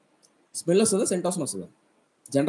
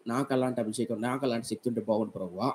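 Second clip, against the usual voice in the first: softer, lower, mild pleading lament; retold tearful prayer; 4 semitones lower, 6 dB quieter than usual. Young William Carey praying to the Lord, soft pleading lament. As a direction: quietly beg the Lord for power. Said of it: "అని ఏడ్చాడు".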